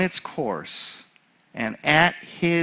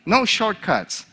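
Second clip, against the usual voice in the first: mild yell, pitched up; raised-pitch retold shout; 6 semitones higher than usual. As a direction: call it out like a coach to the class. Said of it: "And I yelled at them".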